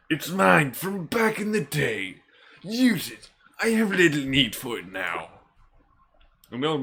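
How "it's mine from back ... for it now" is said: put-on high, drawn-out old man voice; old mentor. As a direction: pass it on like a village elder. Old man hands over weapon.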